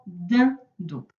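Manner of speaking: "dindon" in two separate beats; teacher sounding out "dindon"; split into syllables. Shown as a demonstration. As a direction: pause between the syllables of "dindon". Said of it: "Est-ce qu'on l'entendait dans timbre".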